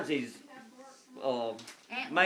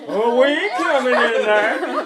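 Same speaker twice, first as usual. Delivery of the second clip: slow, loud, higher refusal; mock cowardly friends; 6 semitones higher, 10 dB louder than usual. Friends refusing to wade into the swamp, mock whine.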